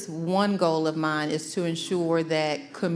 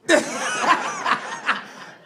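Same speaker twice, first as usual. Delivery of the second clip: high, rolling laugh; hearty laugh. Real, not acted. Superintendent joking back about her singing, hearty laugh. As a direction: burst out in real, high laughter.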